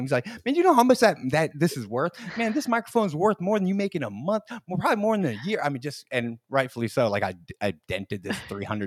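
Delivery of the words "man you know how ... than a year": mock scolding. Host laying into a comic who dented the mic, mock scolding. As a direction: lay into them mockingly, high and animated. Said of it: "just lays into me, just makes fun".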